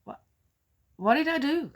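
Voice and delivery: a touch of retold disbelief; surprised. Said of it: "I said".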